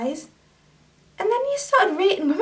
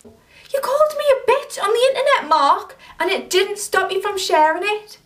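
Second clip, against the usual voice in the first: angry confrontation; 3 semitones higher than usual. Acted. To Mark, for calling her a bitch online: angry and accusing. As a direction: tear into him for what he posted.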